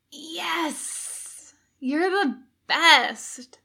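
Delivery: slow, goofy delighted cheer; stoned excitement. A narrator's reading. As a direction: gush like a happy stoner.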